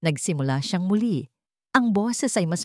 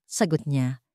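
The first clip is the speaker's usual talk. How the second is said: ordinary delivery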